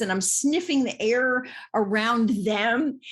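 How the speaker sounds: ordinary talk, nothing marked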